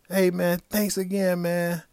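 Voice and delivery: man calling out his gratitude again; eager thanks. Retold or imitated. Panhandler thanks him again.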